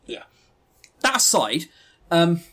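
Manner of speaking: ordinary talk, nothing marked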